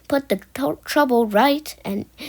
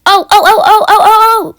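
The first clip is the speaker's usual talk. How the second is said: high, loud string of ohs; trucks' outcry; 9 semitones higher, 12 dB louder, faster than usual. A narrator's reading. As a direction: hoot in mock alarm like a gang of trucks. Trucks cry out as James arrives.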